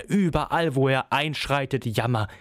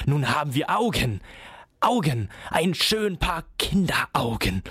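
whispered; 4 semitones higher than usual. A narrator's reading. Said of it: "so flüsterte Coppelius".

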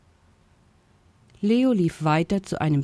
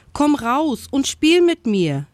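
high, lively coaxing call; eager suggestion; 9 semitones higher, 4 dB louder than usual. A narrator's reading. Leo coaxes the hiding ostrich to play, eager. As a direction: urge someone out to play. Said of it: "schlug er vor".

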